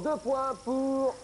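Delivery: high, slow drawn-out points call; game show announcement. Real, not acted. Quiz host to contestants, slow high announcer call. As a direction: stretch the words like a quiz host crowning a winner.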